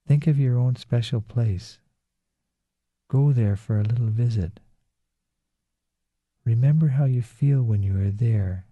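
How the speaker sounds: ordinary talk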